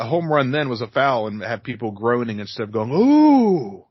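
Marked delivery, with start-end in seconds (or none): 2.9-3.8 excited crowd ooh. Retold or imitated. Crowd cheering a hit.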